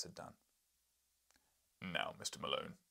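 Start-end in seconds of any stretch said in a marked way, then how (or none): 1.8-2.8 lower, grand address; dignified. Acted. Professor to the journalist, a lower dignified address. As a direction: draw yourself up with dignity. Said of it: "great accession of dignity".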